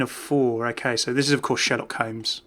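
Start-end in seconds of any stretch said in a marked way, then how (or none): none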